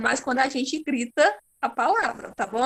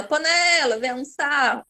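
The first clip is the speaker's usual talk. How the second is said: calling out. Shown as a demonstration. Teacher calling a word as if to lunch, calling out. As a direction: call "panela" to lunch, mildly raised voice. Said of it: "Grita a palavra como quem tá chamando".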